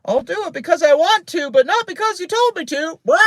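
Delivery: very high, loud squawked mockery; squawking parrot. A narrator's reading. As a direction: parrot mimicking the girl's defiance. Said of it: "Penelope squawked".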